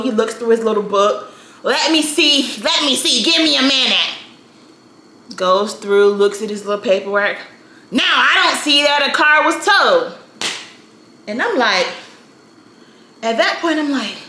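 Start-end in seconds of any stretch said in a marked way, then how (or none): 1.6-4.1 higher-pitched imitation of a fussy old clerk; slow old man. Old clerk leafing through his book, unhurried and grumpy. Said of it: "he's moving all slow". 7.9-10.1 higher-pitched crotchety clerk voice; grumpy old man. Retold or imitated. Old clerk done checking his paperwork, grumpy. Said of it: "he's old and grumpy".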